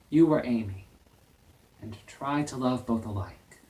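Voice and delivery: earnest stage confession with long pauses; Laurie's monologue. Acted. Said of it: "here is my performance of Laurie's monologue".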